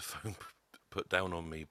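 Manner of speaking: ordinary talk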